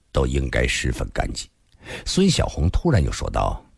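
plain reading voice

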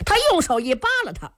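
usual speaking voice

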